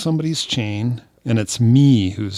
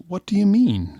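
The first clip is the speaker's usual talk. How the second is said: high put-on puzzled voice; confused stranger; 10 semitones higher than usual. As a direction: ask it baffled, pitched way up. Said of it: "She goes".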